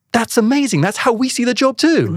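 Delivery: high, loud retold delight; imitated thrilled exclamation; amazed interviewer. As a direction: gush in hammed-up amazement. Interviewer amazed by the read-back answer.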